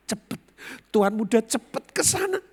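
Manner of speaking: high, excited urging; urgent hurrying. Retold or imitated. Employee rushing the young master to go, urgent and high. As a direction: hurry him along in a high voice.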